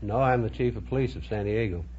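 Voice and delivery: ordinary delivery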